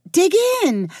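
high, loud hostess call. A narrator's reading. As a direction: call everyone to the food. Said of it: "Michelle called".

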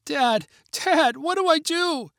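high, panicked cry to her father; frantic yell. A narrator's reading. Girl calling to her father below, high and frantic. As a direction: shout down, frightened and lost. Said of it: "yelled Kara frantically".

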